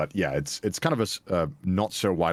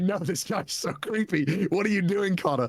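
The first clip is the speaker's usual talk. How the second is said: mock disbelief; 11 semitones higher, slower than usual. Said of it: "And I'm like".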